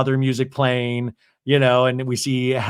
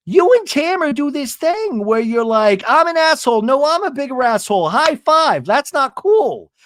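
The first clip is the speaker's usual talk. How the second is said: high, hammed-up bickering voice; retold exasperated mockery; 10 semitones higher, 3 dB louder than usual. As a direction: mimic two friends bragging, then scold. Acted quarrel, mocking two friends.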